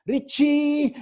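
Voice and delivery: high, loud, drawn-out call for her son; mother calling. Retold or imitated. Mother calling her swami son, high loud call. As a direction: sing the name out loudly, imitating a mother. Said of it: "his mother would call".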